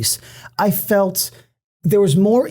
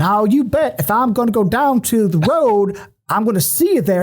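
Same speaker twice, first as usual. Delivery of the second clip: mock Southern accent; 4 semitones higher than usual. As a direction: imitate a clumsy Southern accent. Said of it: "that sounds like me doing the accent".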